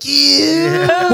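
high, put-on eye-rolling yeah; a grudging, high mock yeah; mock co-host. Mimicking the eye-rolling co-host, high and grudging. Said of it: "he's like".

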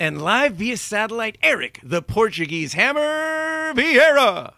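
slow, drawn-out ring announcer; hyped announcer intro. Acted. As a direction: build him up like a fighter's entrance.

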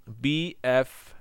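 "B, F" said as separate English letter names; name "B" and "F" distinctly for learners; letter-name demonstration. Shown as a demonstration. Teacher shows students the consonants, clearly pronounced letters. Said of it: "I'm going to pronounce the consonants".

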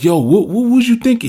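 high, loud exasperated demand; angry confrontation. Retold or imitated. Rider confronting the reckless biker, high loud demand. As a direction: confront him for causing the crash. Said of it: "But I was like".

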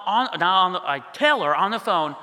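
ordinary delivery

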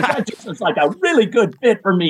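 a quote said through laughter, high; retelling his own line with a laugh; laughing while quoting. Retold or imitated. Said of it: "I said".

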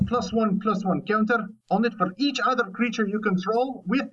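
hammy Scottish reading of a card that slides into a cartoon ogre; bad Scottish accent. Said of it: "I segued into Shrek there halfway through".

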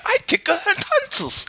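squeaky crude Jar Jar Binks. Pretend. Jar Jar bit continues.

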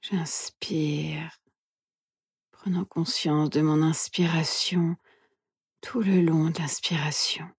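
soft, hushed meditation guide; guided meditation whisper. Acted. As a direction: whisper gently, leaving long pauses.

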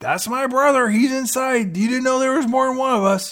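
high-pitched, eager announcement. Retold or imitated. Billionaire points out his brother inside, high-pitched and eager. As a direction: play a hammed-up, high-voiced introduction.

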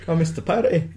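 high hammed-up Scottish accent; mock Scottish accent. Missed cop joking about the party, hammed-up Scottish accent. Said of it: "trying to do a Scottish accent".

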